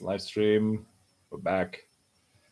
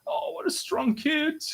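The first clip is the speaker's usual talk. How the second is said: admiring onlooker; 14 semitones higher than usual. Acted. Reader voicing someone moved by a brave child, high admiring coo.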